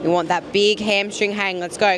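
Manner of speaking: ordinary delivery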